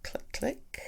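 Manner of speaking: soft unvoiced "click, click" like tapping a screen; whispered click sounds. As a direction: breathe the clicks as a tiny sound effect. Imitating phone tapping sounds.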